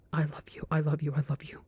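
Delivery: a soft repeated whisper of I love you; whispered confession. A narrator's reading. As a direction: breathe it out, barely voiced. Inner rush of love.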